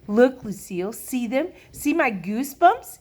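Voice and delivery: eager. A narrator's reading. Sheldon shows Lucille his goosebumps, eagerly. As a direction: show off proudly, urging her to look.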